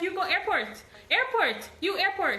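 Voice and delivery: high, insistent broken English; driver. Retold or imitated. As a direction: point her at the airport.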